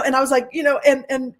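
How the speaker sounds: usual speaking voice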